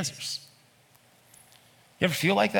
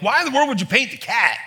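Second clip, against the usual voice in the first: much higher, louder exasperated outburst; exasperated parent; 10 semitones higher, 4 dB louder than usual. Retold or imitated. Parent scolding child over the painted cat, loud high exasperation. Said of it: "in exacerbation with your children".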